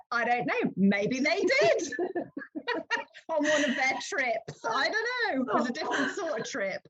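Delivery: high-pitched giggly protest; amused, squeaky-high shrug of an excuse; laughing excuse. Retold or imitated. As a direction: plead innocence through laughter, voice climbing high. Joking excuse for slip, laughing.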